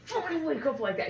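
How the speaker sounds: high, loud mock fury; retold angry outburst. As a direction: imitate someone startled and furious. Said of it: "He was so mad".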